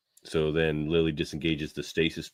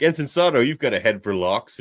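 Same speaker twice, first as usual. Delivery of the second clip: higher, louder captain's compliment; lightly voiced Beverly; 5 semitones higher, 5 dB louder than usual. Beverly praising Sato at the lock, a lightly voiced compliment. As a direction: praise her like a proud captain.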